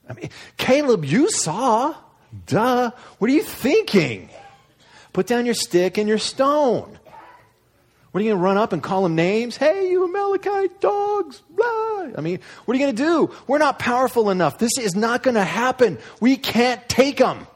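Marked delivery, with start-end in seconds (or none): 0.6-9.6 much higher, louder mocking voice; high whiny scornful put-down; scoffing spies. Spies mocking Caleb's courage. 9.6-12.1 mock a kid yelling names at giants; shrill-pitched playground insult; silly mock taunt. Mock taunt inside spies' quote. 12.2-17.5 much higher, louder mocking voice; high whiny scornful put-down; scoffing spies. Spies mocking Caleb's courage.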